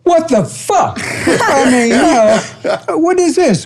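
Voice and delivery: high, disbelieving what the fuck; incredulous outburst. Retold or imitated. Retelling his shock at the rejection form, high outraged disbelief. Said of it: "I phrase it a little bit different".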